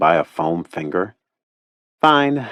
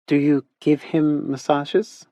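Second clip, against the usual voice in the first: put-on higher, slow little girl voice; cute little girl; 9 semitones higher than usual. A narrator's reading. Caro asks Teddy about massages in a cute little-girl voice. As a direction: ask like a curious small child. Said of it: "cute little button accent".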